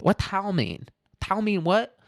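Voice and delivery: put-on high, puzzled caricature Chinese accent; confused mock Chinese accent. Host voices confused followers questioning the emperor, mock Chinese accent. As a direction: ask it in a hammed-up broken English accent. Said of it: "They were like".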